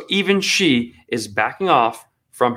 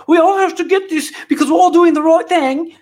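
high, far-off mocking prime minister voice; mocking Jacinda; 12 semitones higher, 3 dB louder than usual. Commentator mocking the New Zealand leader in a high voice. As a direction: sneer it out as the preachy leader. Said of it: "and she's like".

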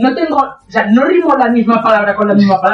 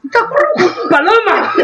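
much higher, squealed mockery; shrieked parody of the lyric; mocking squeal; 10 semitones higher than usual. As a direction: squeal the lyric back in ridicule.

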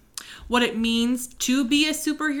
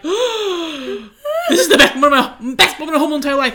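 obsessed fanboy; 3 semitones higher, 4 dB louder, faster than usual. Acted. Nick being saved by his idol, starstruck gushing. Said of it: "he's obsessed with him".

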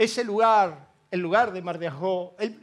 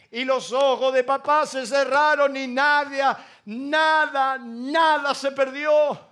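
triumphant proclamation; 4 semitones higher, 5 dB louder, slower than usual. Acted. Triumphant climax.

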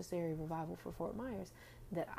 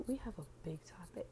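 whispering; 3 dB quieter than usual. Acted. Whispered teaser about big topic.

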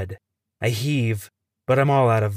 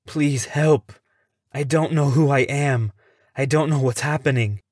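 louder, higher frightened plea; pleading; 4 semitones higher, 3 dB louder than usual. Acted. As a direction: beg them, scared and confused. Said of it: "I repeat my plea".

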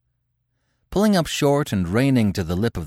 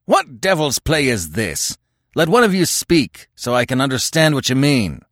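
bull-like roar, pitched up and forceful; bellowing; 5 semitones higher, 3 dB louder than usual. Acted. Conan beats down the clamor of the tribesmen, bellowing. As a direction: bellow over a noisy crowd. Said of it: "bull-like roar and bellowed".

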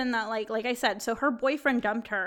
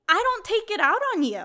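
high, louder indignant retort; retold straight-up telling-off; 10 semitones higher, 5 dB louder than usual. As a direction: push the pitch up in reenacted protest. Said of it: "I told her straight up".

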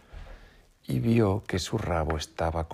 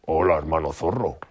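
surprised wolf; 6 dB louder than usual. A narrator's reading.

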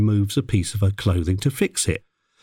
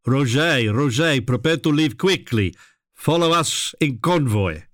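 put-on higher-pitched, urgent French accent; French-accented production assistant; 5 semitones higher than usual. Production assistant ordering the driver to leave quickly, urgent French accent. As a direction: hurry them along in a French accent. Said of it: "A production assistant came to my vehicle and said".

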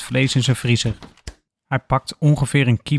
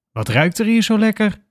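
higher, calling from the hallway; curious call; 8 semitones higher than usual. A narrator's reading. Roommate calling from the hall about the smell, higher curious call.

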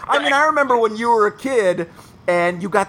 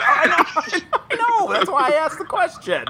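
high, laughing through the words; laughing admission; 9 semitones higher than usual. Retold or imitated. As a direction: giggle out a guilty confession. Imagined reply, laughing.

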